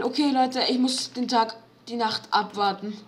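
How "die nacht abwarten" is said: usual speaking voice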